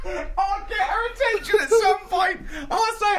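words squeezed out through high laughter; laughing through words. Real, not acted. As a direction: repeat the line while cracking up. Repeating it while laughing.

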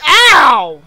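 very loud, high "ow"; pained shout. Real, not acted. Player hit by the woman, loud pained cry.

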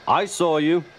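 stern telling-off. A narrator's reading. Controller catches naughty Thomas out.

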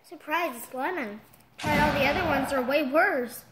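loud, excited outburst. Real, not acted. Reacting loudly to the candy.

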